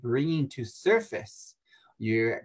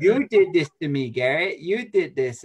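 mock accusing whine, louder; accusing client; 4 semitones higher, 6 dB louder than usual. Client blaming Garrett, mock accusing whine. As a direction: play the blaming client pointing at him.

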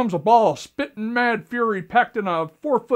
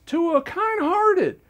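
mock indignant; 7 semitones higher, slower than usual. Mock outraged self-praise.

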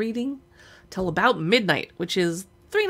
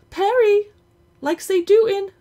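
high, loud taunting cry; teasing squeal; 12 semitones higher, 9 dB louder than usual. Acted. Brendy shrieking at her brother, high taunting cry. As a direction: shriek it to needle him. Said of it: "You just want her to say".

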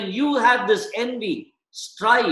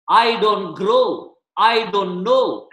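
slow and louder, every word weighed; slow emphatic beats; 5 dB louder, slower than usual. Acted. Pastor pressing the congregation, slow and louder. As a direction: lean hard on each rhyme, slowly. Preacher hammers rhyming points.

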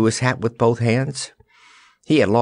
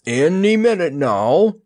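predicting; 9 semitones higher, 3 dB louder than usual. A narrator's reading. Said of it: "predicted the Wizard".

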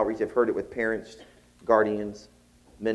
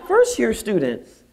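loud, high, drawn-out FIRST YEAR STUDENTS; emphatic realization; 11 semitones higher, 8 dB louder, slower than usual. Retold or imitated. Professor grasping who is writing, emphatic slow realization.

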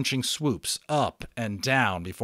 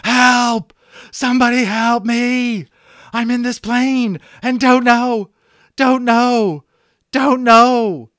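much higher, much louder desperate screams; panicked screaming; 13 semitones higher, 13 dB louder, slower than usual. Acted. Stranded boy screaming into the radio for help, frantic. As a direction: scream for your life into the mic. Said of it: "he began to scream into the microphone".

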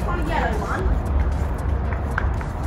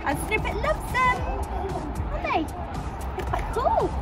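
much higher cooing pet voice; talking to a pet; 8 semitones higher, 5 dB quieter than usual. Real, not acted. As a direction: coo sweetly at the pony. Talking to pony about mats.

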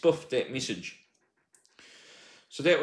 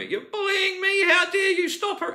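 mocking her messages; 4 dB louder than usual. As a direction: draw it out high, mimicking her. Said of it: "The messages started again from her".

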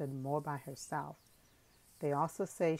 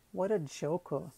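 laughing; 2 semitones higher, 4 dB louder than usual. A narrator's reading. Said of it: "Zenata laughed".

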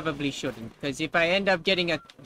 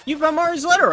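mock announcer read; 11 semitones higher, 7 dB louder, faster than usual. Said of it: "reads game text aloud".

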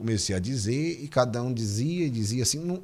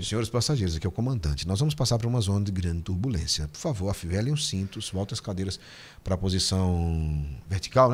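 pilot announcement; 6 semitones lower, 4 dB quieter than usual. Retold or imitated. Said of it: "O som é diferente e tudo".

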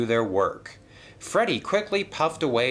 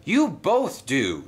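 higher, drawn-out mild shout; moderately raised voice, stretched words; shouted interjection; 7 semitones higher, 4 dB louder, slower than usual. Acted. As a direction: cut into the squabble, slow and raised. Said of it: "shouted Colin".

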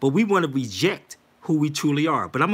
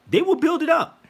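mock squeal; 13 semitones higher, 4 dB louder than usual. Mocking Europeans building.

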